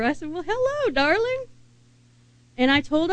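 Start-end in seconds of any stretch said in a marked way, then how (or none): 0.3-1.4 much higher, bright sing-song "Well, hello, darling"; cheerful greeting back. Retold or imitated. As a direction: greet her back, lifting the voice way up. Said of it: "I repeated it back to her".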